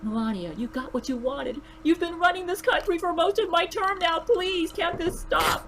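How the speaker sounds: high, pleading man's voice. A narrator's reading. Donald pleads with Melania.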